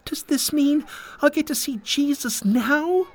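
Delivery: put-on very high little girl's voice; hopeful girl. A narrator's reading. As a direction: ask hopefully, in a child's high pitch. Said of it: "The hopeful girl tugged at her mother's hand".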